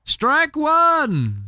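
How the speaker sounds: high, ringing umpire call; umpire's call. Acted. Umpire calls the strike.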